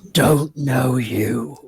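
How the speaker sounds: slow, low creature voice; wolf-man voice. Acted. Wolf-man failing to recognize an old friend, slow low voice. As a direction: drag each word out low, as a wary beast.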